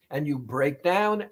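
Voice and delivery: ordinary talk, nothing marked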